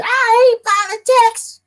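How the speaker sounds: high, slow exaggerated Southern drawl; squealed Southern twang; Southern accent. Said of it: "In a Southern accent".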